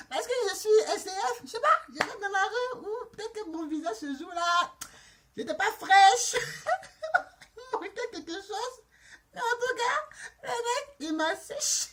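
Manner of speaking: high-pitched helpless laughter through the words; laughing. Real, not acted. Storyteller joking she looked rough that day, laughing. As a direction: barely get it out for laughing. Cracking up over her own looks.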